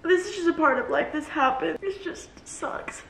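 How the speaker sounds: choked up, trying to accept it; tearful. Real, not acted. Vlogger telling viewers about losing the job, tearful.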